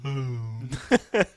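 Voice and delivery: mopey pout pout fish. Retold or imitated. Imagined fish answering the pigeon, slow gloomy mope. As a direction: sigh out the misery. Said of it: "pout pout fish is gonna be like".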